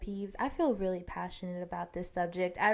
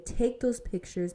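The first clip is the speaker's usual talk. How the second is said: ordinary talk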